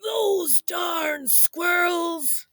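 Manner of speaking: put-on high, slow old-man shout for all to join; grumpy old man yell. Shown as a demonstration. Storyteller leads kids in the old man's cranky cry. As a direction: lead the crowd in a cranky yell. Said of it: "Say it with me".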